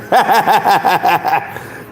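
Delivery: long rolling high laugh; real laugh. Real, not acted. Host tickled by the nickname, laughing hard. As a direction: crack up in a run of chuckles.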